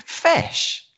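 high incredulous Fish?, whispered; can't believe what he just heard. Real, not acted. Host asks back in high disbelief. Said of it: "You what?".

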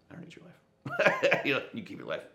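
laughing it off. Real, not acted. Laughing as he tells the man to keep his life. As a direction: chuckle through the reassurance.

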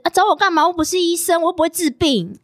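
lightly imitated careless boyfriend; mimicking his tone. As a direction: toss it off like an annoyed brush-off. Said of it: "你要加上你的口气。".

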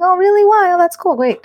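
high, bright "oh really?" of feigned interest; mock surprise. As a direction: act amazed for your kid. Mother feigning interest to daughter.

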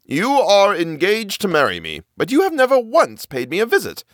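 a hint of a smooth suitor; bridegroom voice. A narrator's reading. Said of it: "One time he said to her".

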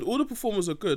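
ordinary talk

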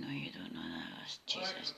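very low, barely audible whisper; exasperated whisper. Real, not acted. Woman to unhelpful voice assistant, whispering in exasperation. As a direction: grumble it under your breath, whispered.

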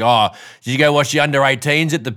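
a loud local from the footy club, overplayed; local Aussie footy bloke. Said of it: "Like, it's like".

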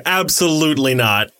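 ordinary delivery

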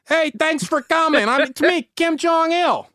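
high-pitched comic greeting call; Kim Jong-il. Retold or imitated. Dictator greets his abductee at the wharf, mock calling out. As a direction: call out a jolly, hammed-up welcome. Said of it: "she hears a deep voice call out".

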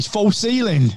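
high mock southern English "false healing". Mate mocking how force healing came out, southern English accent. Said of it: "anytime you put any southern accent on".

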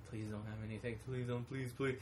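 soft, hushed begging, repeated; nervous kid pleading quietly; anxious pleading. Retold or imitated. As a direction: plead under your breath. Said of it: "I was so stressing".

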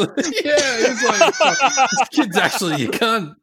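laughing through the words. Real, not acted. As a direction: talk while cracking up. Laughing while telling.

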